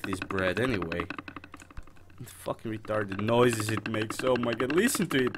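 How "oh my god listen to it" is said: ordinary delivery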